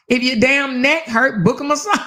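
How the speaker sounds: high, slowed and emphatic; emphatic repeat. Retold or imitated. Telling women what husband says, high and emphatic. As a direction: hammer the advice home.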